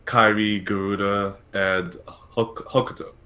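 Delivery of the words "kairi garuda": ordinary talk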